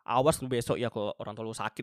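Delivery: usual speaking voice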